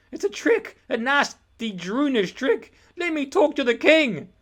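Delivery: very high, loud, pleading yell; boy crying out to reach the king; desperate shout. Acted. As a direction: scream it as you're dragged off. Eric protesting while dragged.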